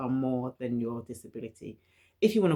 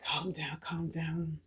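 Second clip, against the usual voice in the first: hushed self-soothing inner voice; quiet, acted-out internal pep talk; talking oneself down; 2 semitones higher, 4 dB quieter, slower than usual. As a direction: softly imitate calming yourself under your breath. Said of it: "trying to talk myself down".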